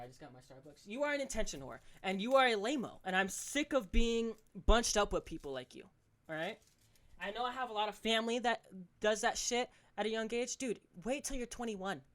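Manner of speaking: ordinary talk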